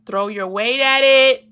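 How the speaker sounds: loud slow title call. Acted. Host announcing the topic title, loud and drawn out. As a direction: belt the title out slowly.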